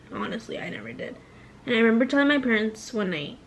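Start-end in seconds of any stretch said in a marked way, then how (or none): 0.1-1.2 whispered aside. Real, not acted.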